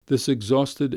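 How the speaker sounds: plain reading voice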